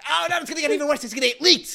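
loud, high, frantic mad scientist; Doc Brown impression. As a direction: warn him like a panicked Doc Brown. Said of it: "another Christopher Lloyd".

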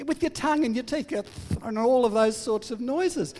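put-on begging child. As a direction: wheedle like an excited child pleading.

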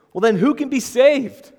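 high, loud astonished question; voicing the stunned disciples. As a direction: gasp it out in disbelief. Disciples' astonished question read aloud.

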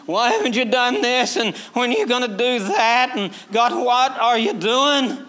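high, loud mock whine at God; whinging pray-er. Imitating Christians who moan at God in prayer, whiny. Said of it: "moaning and groaning and whinging".

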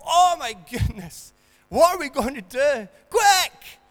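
high, loud panicked shriek of a flustered doctor; panicking doctor. Acted. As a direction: shout it like a doctor in total panic. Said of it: "do you want the doctor to scream".

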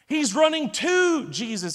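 slow, leaning hard on "to" in "He's running to Jesus"; "to" held long and stressed against running away. Shown as a demonstration. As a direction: draw out "to", stretching it before "Jesus". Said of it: "He's not running away from Jesus".

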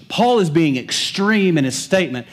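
normal talking voice

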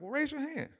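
jumps way up high for the friend; squeaky high sidekick voice, lightly mocking; friend's voice. Friend answers Waterboy in class.

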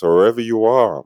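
ordinary talk

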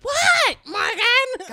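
sudden high shout of the name; mock shout. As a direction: shriek the name in shock. Sisters retell mom's reply.

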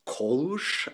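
Kolush with o in the first syllable and final sh; Hungarian pronunciation. Shown as a demonstration. Guest guessing at the Hungarian original, Kolush with an o and final sh. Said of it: "I think would be in Hungarian".

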